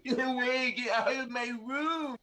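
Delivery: panicked yell. Acted. Game master voices cowering foe ordering intruder out, yelling. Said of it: "he's yelling".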